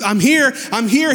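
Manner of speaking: high, eager calling out; thrilled to be close at last; excited. Retold or imitated. Jerry with the metal detector, excitedly calling out. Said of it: "He gets excited".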